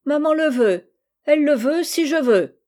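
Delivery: high, loud and teasing, a mocking echo; sly little taunt mimicking her uncle; mischievous. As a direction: throw his words back at him slyly. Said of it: "Gisèle avec malice".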